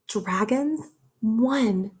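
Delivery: usual speaking voice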